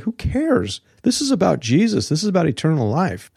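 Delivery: high-pitched, mock dismissive voice; acted-out carefree disciples, high then easing down; dismissive retort. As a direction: shrug it off, pitched well up. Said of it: "They're like".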